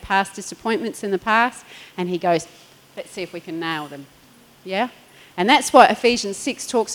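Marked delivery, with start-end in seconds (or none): 3.0-4.1 very soft, low, scheming murmur; a hint of a conniving devil; sly devil voice. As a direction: plot it under your breath like the enemy. The devil plots an attack.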